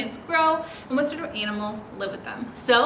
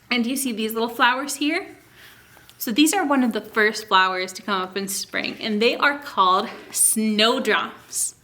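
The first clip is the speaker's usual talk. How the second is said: normal talking voice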